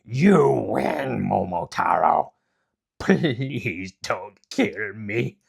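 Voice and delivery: loud, very slow pleading; begging king. Acted. Defeated demon king pleads with Momotaro, slow and loud. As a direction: beg for your life.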